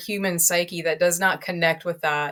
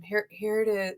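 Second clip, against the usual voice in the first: dull agent; 8 dB quieter than usual. Retold or imitated. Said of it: "If you have some personality behind it".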